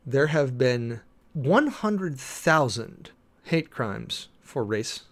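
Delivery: ordinary talk, nothing marked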